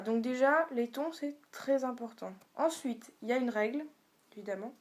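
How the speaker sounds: ordinary delivery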